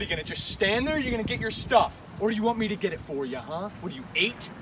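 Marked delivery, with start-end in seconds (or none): none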